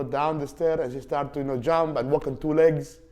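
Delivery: ordinary delivery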